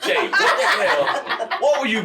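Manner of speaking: high, slow laughing what the hell; host laughing in disbelief. Retold or imitated. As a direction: crack up at the Vaseline. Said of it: "Alex Thomas grabbed me".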